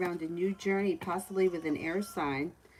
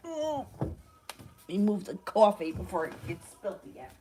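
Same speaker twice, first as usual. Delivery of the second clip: usual speaking voice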